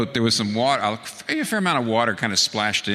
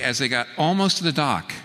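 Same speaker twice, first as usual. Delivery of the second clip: ordinary talk, nothing marked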